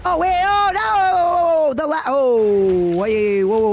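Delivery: high, loud "oh no" cry; sudden panic as the game goes wrong; alarmed. Real, not acted. Streamer panics at the lag in her run, high and loud.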